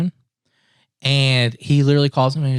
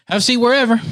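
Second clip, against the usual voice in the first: much higher, casual invitation; imitated breezy officer; 10 semitones higher, faster than usual. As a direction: mimic an easygoing cop offering a seat. Officer casually offering a seat.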